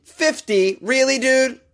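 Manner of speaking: high-pitched, disbelieving outburst; incredulous. Real, not acted. Podcaster reacting to a grown man's age in a news story, incredulous. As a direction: pitch leaps up in stunned disbelief.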